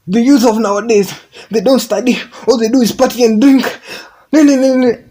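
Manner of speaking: high, loud mock rant; frustrated old man. Benjamin Zulu ranting about youth, high loud mock complaint. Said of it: "He was like".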